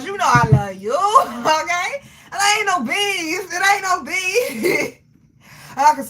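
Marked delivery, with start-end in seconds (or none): 0.9-3.5 laughing reassurance. Real, not acted. 3.5-5.0 laughing reassurance. Real, not acted.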